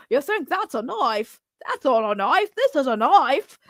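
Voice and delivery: hammed-up bad Australian accent; mock a terrible Aussie accent, drawing out the last words; horrible Australian accent. Streamer ridiculing Ethan's fake accent, horrible mock Australian accent. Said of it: "that horrible accent he put on".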